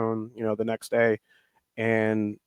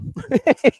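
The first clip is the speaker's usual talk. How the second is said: high character laugh; 13 semitones higher, 5 dB louder than usual. Real, not acted. Laughing at fooling people.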